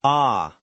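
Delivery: Ah said loud with the mouth open wide. Shown as a demonstration. Host copies the long ah for listeners, mouth wide open. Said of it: "my mouth is open wide, like at the dentist".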